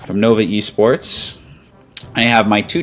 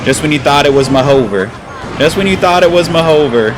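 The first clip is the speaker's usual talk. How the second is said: higher and louder victory line; triumphant gloat; 6 semitones higher, 4 dB louder than usual. Real, not acted. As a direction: rub in the comeback. Gloating after comeback.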